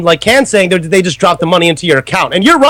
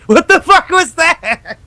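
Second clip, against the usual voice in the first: higher, slow words through laughter; laughing disbelief; 9 semitones higher, slower than usual. Real, not acted. Host asks the prankster what that was, laughing. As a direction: ask it while still cracking up. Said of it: "I'm laughing so goddamn hard".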